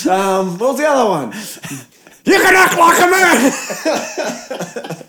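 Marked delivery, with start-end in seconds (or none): 2.3-5.1 very high, slow shouting; movie impression. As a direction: bellow it like a mobster. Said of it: "movie that you imitate".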